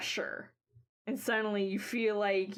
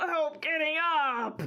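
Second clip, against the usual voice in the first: pained laugh, pitched high; strained laughing plea; 7 semitones higher, 4 dB louder than usual. Acted. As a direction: laugh weakly while asking for help. Squall weakly asking for help.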